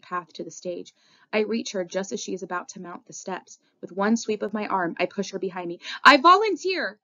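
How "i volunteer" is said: high, loud urgent declaration; gasped outcry. A narrator's reading. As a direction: cry it out with a gasp. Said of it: "I gasp".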